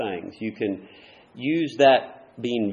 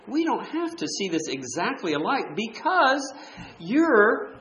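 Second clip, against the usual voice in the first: high, sing-song mock voice; mimicking a denominational believer; 8 semitones higher than usual. Preacher mocking a denominational believer's reply, high sing-song. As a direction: send up their sweet, agreeable tone, pitched high.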